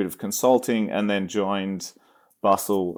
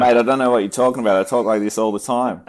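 louder, hammed-up Aussie protest; exaggerated Australian accent; 3 semitones higher, 5 dB louder, faster than usual. Guest jokingly insisting he always talks so, exaggerated Australian accent. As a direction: keep up the broad Australian act. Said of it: "if I did speak like that".

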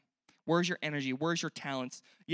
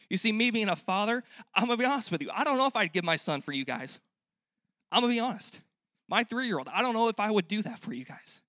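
higher, louder candid admission; impassioned confession; 6 semitones higher, 5 dB louder than usual. Acted. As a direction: own up hard to the congregation. Confessing loudly as a father.

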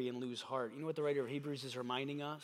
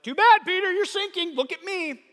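much higher and louder, sarcastic jab; hammed-up uncaring Jesus scolding Peter; loud, sing-song brush-off; mock dismissive Jesus; 14 dB louder than usual. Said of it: "was like".

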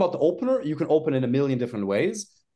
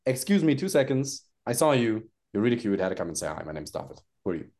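calm opener; 6 semitones lower than usual. Shown as a demonstration. Coach demos the daytime line to the host, low and calm. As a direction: keep it very calm. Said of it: "But during the day".

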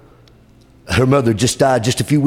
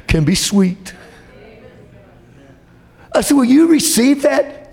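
fervent preaching; 11 semitones higher than usual. Acted. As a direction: preach it with rising fervour. Preaching at full force.

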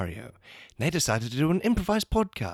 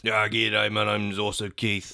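Australian bloke; 5 semitones lower than usual. Acted. Rural Aussie.

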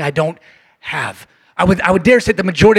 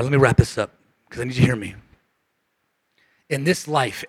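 ordinary talk, nothing marked

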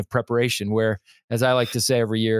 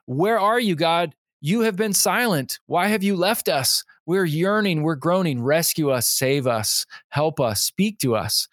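lightly acted lament, crying out to God; pleading people of God; 6 semitones higher than usual. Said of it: "where God's people said".

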